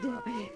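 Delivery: a bright, high laugh of relief; light happy laugh; delighted little laugh. Acted. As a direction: laugh with pleasure. Pleased about going home.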